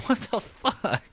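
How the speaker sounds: slow high incredulous exclamation; disbelief. Real, not acted. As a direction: stretch it out in stunned disbelief. Reacting to family statement.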